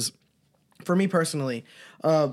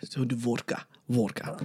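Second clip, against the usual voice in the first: put-on low, quiet Russian accent; put-on Russian, deep and soft; mock Russian accent; 8 semitones lower, 8 dB quieter, slower than usual. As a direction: drop down into a hushed Russian accent. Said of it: "Do, like, a Russian accent".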